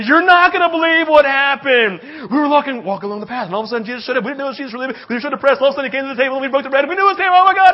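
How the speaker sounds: high, loud, gushing disciples tumbling over their words; bursting to tell the news; building from eager to shouting Oh my God; breathless excitement. Acted. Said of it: "They say".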